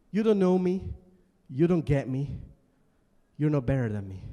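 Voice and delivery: loud, bitter accusation; emotional outburst. Acted. Student at school accusing his classmates, loud. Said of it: "I got a bit emotional".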